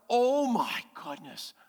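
slow, wonder-struck gasp of a new parent; awed parent. Retold or imitated. Parent marveling at a newborn, slow and awed. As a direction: breathe it out in amazement. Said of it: "You're like".

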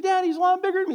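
put-on little boy pleading. Little boy answers his dad.

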